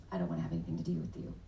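sulky son voice. Retold or imitated. Son's rejection voiced.

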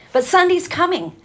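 Sunday's lifted high, a ringing preacher's call; preacher's cry. Retold or imitated. Quoting the great preachers, ringing preacher's call. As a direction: call it out like an old-time preacher. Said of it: "great preachers used to preach".

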